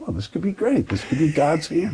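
high, lightly mock-hopeful self-quote; hopeful excitement. Recalling his Yale hopes to the host, mock-excited. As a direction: imitate your own eager thought, pitched well up.

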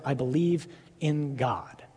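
unvoiced, slow whisper of "I believe in God"; quiet whispered declaration of faith. Shown as a demonstration. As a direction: breathe "I believe in God" as a hushed vow. Repeating the declaration.

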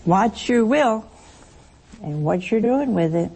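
ordinary talk

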